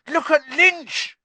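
high, loud, drawn-out yell; excited spectator's shout. A narrator's reading. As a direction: yell it urgently across the crowd. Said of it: "Harry yelled".

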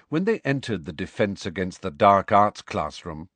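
ordinary delivery

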